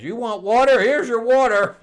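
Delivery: high, loud mocking frog statue. Frog statue offering the heroes water, high and loud. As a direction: squeal it out like a smug stone frog.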